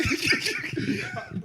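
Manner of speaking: breathy high-pitched giggle; high airy laugh. Real, not acted. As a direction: burst into a wheezy laugh. Laughing at the idea.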